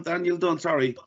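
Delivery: ordinary delivery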